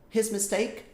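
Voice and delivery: usual speaking voice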